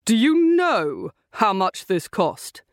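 indignant, slow outburst; angry shout. A narrator's reading. Driver berating his brother about his damaged car, angry shout. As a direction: shout it slowly, full of outrage. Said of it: "he shouted at the Aston Martin's driver".

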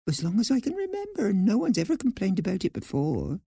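very high little mouse voice; puzzled Nutmeg, reassuring herself. A narrator's reading. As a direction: think aloud as a puzzled small mouse. Narrator voices mouse heroine thinking.

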